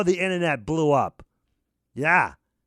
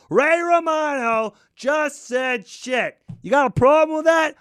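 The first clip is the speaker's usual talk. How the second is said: shouted challenge; 7 semitones higher than usual. Acted. Boasting he just swore, shouting defiantly. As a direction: shout it proudly.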